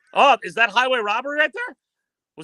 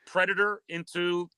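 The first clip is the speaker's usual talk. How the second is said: lower, unhurried wildlife-film narrator voice; nature documentary narration; 5 semitones lower than usual. Acted. Calling a lizard versus kangaroo clip for the host, lower documentary narration.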